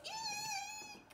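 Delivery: very high, long cartoon squeal of fright; scared squeal. Train scared of the high dive, high mock squeal. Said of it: "is scared".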